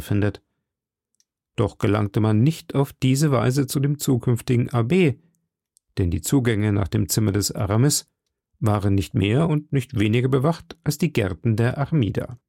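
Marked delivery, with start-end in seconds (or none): none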